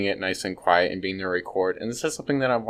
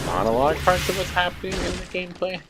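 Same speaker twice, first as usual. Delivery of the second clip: higher-pitched note of disappointment; voicing disappointment; 10 semitones higher than usual. Real, not acted. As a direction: let the pitch climb as you voice disappointment. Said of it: "resonate with my disappointment".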